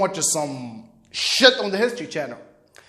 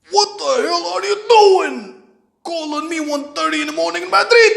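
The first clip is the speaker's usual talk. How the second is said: put-on Moroccan accent; 9 semitones higher, 5 dB louder than usual. Father woken by son's late-night call, angry Moroccan accent. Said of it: "with slight Moroccan accent".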